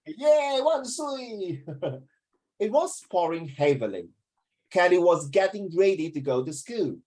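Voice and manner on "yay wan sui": high, laughing mock hooray; mock cheer. Teacher mocking a student cheering, laughing. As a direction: cheer in jest at a silly pupil.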